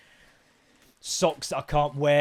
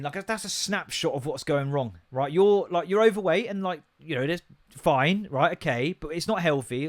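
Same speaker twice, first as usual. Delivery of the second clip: usual speaking voice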